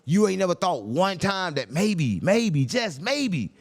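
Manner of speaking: slow with pauses on "maybe, just maybe"; slow down to needle him; sarcastic slow build. Real, not acted. Sarcastic suggestion, slowed.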